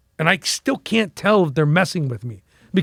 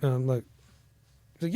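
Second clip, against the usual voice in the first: usual speaking voice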